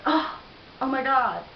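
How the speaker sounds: high, loud gushing oh-my-God; hammed-up amazement; mock excitement. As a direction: imitate a gushing stranger. Mimics the guy's reaction.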